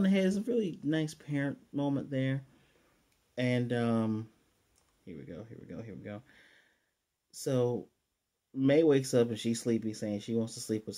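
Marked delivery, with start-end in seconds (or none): 5.1-6.2 soft, low mutter under the breath; murmured to self. Real, not acted. Vlogger quietly muttering to self before going on. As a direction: murmur it to yourself while getting ready. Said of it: "vlogger settling in to continue".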